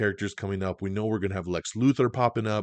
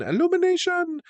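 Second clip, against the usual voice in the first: sung-out high Illumination! with a sweeping fall; announcer flourish; 3 dB louder than usual. Shown as a demonstration. To viewers, hyping the studio like a fanfare.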